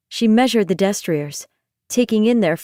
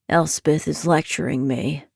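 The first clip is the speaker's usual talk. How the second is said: deadpan complaint; 4 semitones lower than usual. Acted. Nightmare complains.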